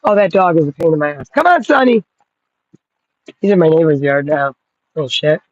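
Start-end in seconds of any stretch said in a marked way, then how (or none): 1.3-2.0 high, sing-song call to a dog; high coaxing call to the dog. Real, not acted. Calling the dog over.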